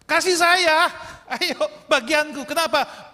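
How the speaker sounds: high, loud greedy demand; spoiled son yelling at his father for his inheritance; demanding shout. Retold or imitated.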